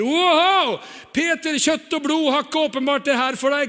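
high, loud, delighted Jesus praising Peter; excited Jesus. Acted. Jesus praising Peter, high and loud. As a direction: burst out in amazed praise. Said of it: "Jesus sier".

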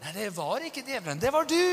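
rising to a high, loud accusation; indignant retort. Acted. Spouse rejecting the devil excuse, indignant retort. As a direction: throw the blame back, punching the last word.

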